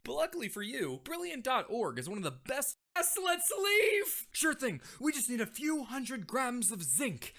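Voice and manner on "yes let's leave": high, slow excited yell; eager shout. Acted. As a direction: leap at the chance. Eager escape cry.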